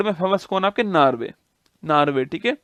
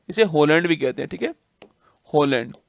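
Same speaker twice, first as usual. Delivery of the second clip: usual speaking voice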